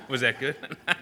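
tickled by your own performance; amused. Real, not acted. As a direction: ask it through a real laugh. Laughing at own accent attempt.

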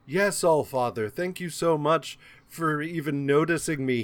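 lightly mocked fawning servant; feigned gratitude. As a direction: put on a pretense of grateful deference. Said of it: "does not have to have the pretense of".

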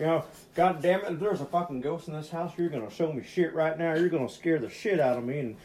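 ex-husband taunting the ghost, hammed-up angry yell; angry challenge. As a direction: bluster at the empty house, daring it.

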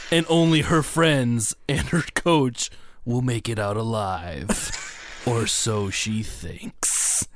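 loud, laughing horror trailer read. Acted. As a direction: build it up, then drop to a hush. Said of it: "I got one".

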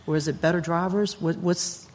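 ordinary talk, nothing marked

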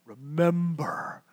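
low, slow, drawn-out movie voice; Lion King impression. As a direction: intone it slowly like a voice from the sky. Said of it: "It's like the Lion King".

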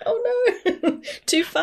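high laughing oh no, too far; laughing mock dismay. Friend laughing at son's Mars plans, mock dismay. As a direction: squeal it through a laugh in mock alarm. Joking reaction to Mars idea.

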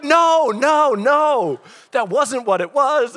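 high, loud comic panic; frantic denial. Retold or imitated. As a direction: protest wildly, pitch way up. Retold frantic denial to Becca.

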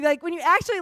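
ordinary delivery

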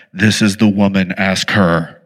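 very deep, loud voice from heaven; booming God voice. Acted. God pointing out the widow to the prophet, booming voice. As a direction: play God with a low, commanding boom.

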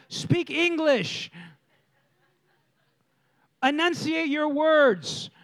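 very slow, drawn-out words; mock parental impatience; exasperated parent. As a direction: spell it out slowly for a mumbling kid. Exasperated parent correcting mumbling child.

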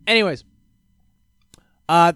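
usual speaking voice

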